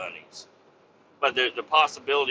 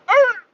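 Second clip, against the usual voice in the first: sharp high yelp, much higher and louder; dog yelp; 10 dB louder than usual. Retold or imitated. Imitating the dog's yelp after the mower hit it. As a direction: imitate a hurt dog's squeal. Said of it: "I heard him go".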